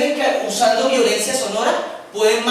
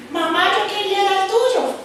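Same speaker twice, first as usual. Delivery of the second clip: voz aguda de niño; niño caprichoso; 10 semitones higher than usual. Acted. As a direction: reclama como un niño mimado. Niño pide lo de la mamá. (niño que reclama con voz aguda)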